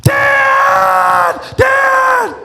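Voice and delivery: put-on high, loud child calling Dad; kid shouting Dad. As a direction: yell for dad like a needy kid. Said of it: "so many other things that scream our name".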